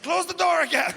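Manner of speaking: high, loud mock command; imitating a girlfriend shouting an order; girlfriend's yell. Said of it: "now she's like".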